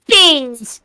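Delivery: put-on high, babyish little voice; Baby Freckle voice. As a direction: say it like a tiny kid. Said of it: "the line you did for Baby Freckle".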